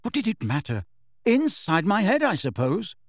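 mildly cross, pitch jumping mid-reply; rather angry. A narrator's reading. Harry answers Dumbledore's question, rather angrily. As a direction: answer with a touch of irritation. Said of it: "said Harry rather angrily".

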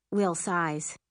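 plain reading voice

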